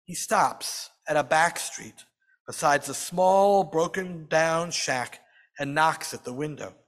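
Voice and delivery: ordinary delivery, nothing marked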